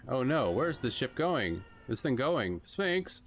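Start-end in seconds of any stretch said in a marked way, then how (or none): 2.7-3.2 high call after someone leaving. Acted. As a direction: call his name, rising in alarm. Hero calls after the Sphinx.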